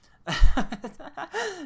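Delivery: big, high burst of laughter; loud laugh. Real, not acted. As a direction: crack up loudly.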